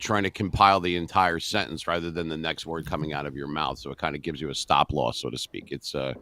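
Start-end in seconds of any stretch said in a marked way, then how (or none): 5.7-6.1 filler uh. Shown as a demonstration. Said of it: "like right there".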